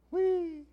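high, drawn-out vocal sound effect; playful whee. Pretend. Preacher filling in for failed sound effects, high playful whee. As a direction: squeal a long, level whee.

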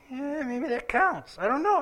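high, slow, noncommittal waver; skeptical hedging. Real, not acted. Preacher weighing whether monthly absence counts, high doubtful hedging. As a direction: draw it out high, as if unconvinced.

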